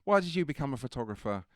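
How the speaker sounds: breezy curious host; imitated Bailey. Imitating Bailey quizzing the young photographer, breezy. Bailey voiced asking question.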